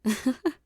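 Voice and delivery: a short, pleased chuckle; laugh, charmed by her nerve; amused laugh. Acted. Boss laughing at his partner's defiance, amused chuckle.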